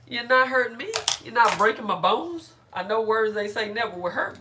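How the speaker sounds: ordinary talk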